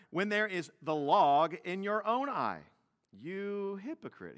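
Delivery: loud, earnest reading of the verse; scripture reading. Acted. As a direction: proclaim the verse to the church.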